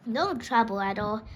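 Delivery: usual speaking voice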